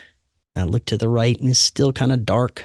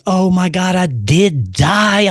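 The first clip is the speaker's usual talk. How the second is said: panicked realisation; 7 semitones higher, slower than usual. Retold or imitated. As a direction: stretch out the dread. Panic believing he died.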